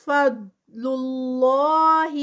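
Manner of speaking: فضل اللہ slow and loud, heavy ض and a stretched اللہ; Quran recitation. Shown as a demonstration. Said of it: "داد heavy ہے".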